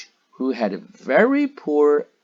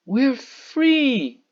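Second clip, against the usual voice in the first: high, loud joyful cry; 13 semitones higher, 6 dB louder than usual. A narrator's reading. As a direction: burst out with relief. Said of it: "she cried".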